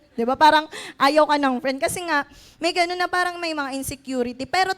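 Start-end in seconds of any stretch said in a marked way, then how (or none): none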